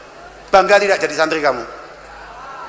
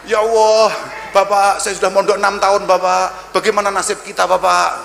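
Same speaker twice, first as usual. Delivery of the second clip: whiny santri plea. Pretend. Santri whining to the kiai about finding a wife, pleading. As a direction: wail it like a desperate student.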